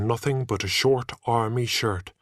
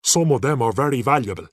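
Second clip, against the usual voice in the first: cross; 2 semitones higher, 5 dB louder, much faster than usual. A narrator's reading. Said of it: "he said crossly".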